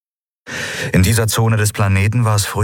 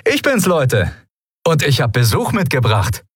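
cheerful greeting; 8 semitones higher than usual. A narrator's reading. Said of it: "in bester Laune".

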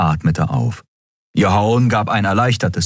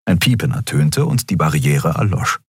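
ordinary delivery

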